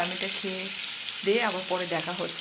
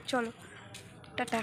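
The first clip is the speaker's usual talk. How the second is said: ordinary talk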